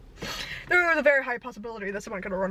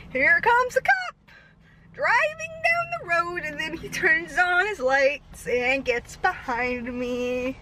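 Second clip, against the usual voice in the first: dramatic announcement; 3 semitones higher, slower than usual. Retold or imitated. Dramatic cop arrival.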